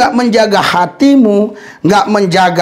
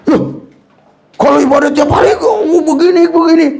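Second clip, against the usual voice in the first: mock surprise; 8 semitones higher than usual. Pastor imitates a church member baffled by daily worship, mock surprise. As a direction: play it up as baffled and amused.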